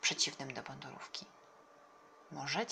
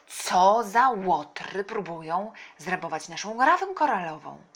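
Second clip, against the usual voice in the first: indignant call; 2 semitones higher, 9 dB louder than usual. A narrator's reading. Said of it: "zawołał wuj". (głośne, powolne oburzenie)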